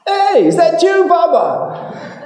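very high, loud call; Pope hailing Bubba. Acted. The Pope calling down to Bubba, very high and loud. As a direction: sing it out from the balcony. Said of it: "so the Pope goes".

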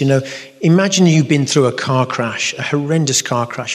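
normal talking voice

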